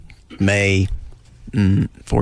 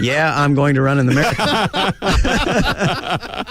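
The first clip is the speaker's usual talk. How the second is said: slow, higher, mock-earnest readout; quoted literally in print; 6 semitones higher, much slower than usual. Guest showing how print reads his joke, slow mock-earnest. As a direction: spell it out slowly as a solemn pledge, half laughing. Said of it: "and it's quoted".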